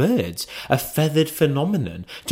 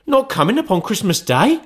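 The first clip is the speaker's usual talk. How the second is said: high, rising dismayed question; father can't believe it; 11 semitones higher than usual. Acted. Bob's disappointment.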